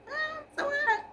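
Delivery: high startled yelp at the heat; hot food yelp. Real, not acted. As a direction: yelp from burning fingers.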